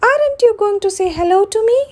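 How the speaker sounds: high, quick squeaky goblin question; little goblin voice. Acted. Goblin asks rude Mister Uppity for a hello, high quick squeak. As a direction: chirp it up fast like a cheeky goblin. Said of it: "a little voice".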